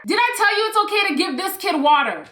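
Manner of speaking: bossy mother. Retold or imitated. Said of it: "and she's like".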